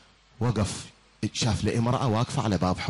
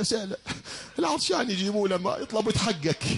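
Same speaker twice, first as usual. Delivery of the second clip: woman's voice; 9 semitones higher than usual. Acted. Woman's voice in retold story.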